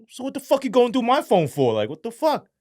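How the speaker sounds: high, loud indignant demand; retold angry confrontation. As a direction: confront him in outraged disbelief. Confronting handler over phone search.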